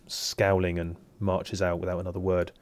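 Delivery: ordinary talk, nothing marked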